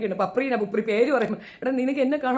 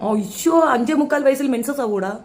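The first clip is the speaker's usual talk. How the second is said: loud, raised disbelief; incredulous outburst; 4 semitones higher, 4 dB louder than usual. Real, not acted. Host scoffing at guest's age claim, loud disbelief.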